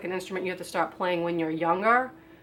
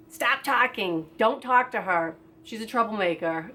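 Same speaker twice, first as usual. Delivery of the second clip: mild imitation of a yelling coach, slightly raised pitch; mock coach yell; 3 semitones higher than usual. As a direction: scold like an exasperated coach, only half yelling. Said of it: "would yell at me".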